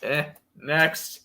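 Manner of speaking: usual speaking voice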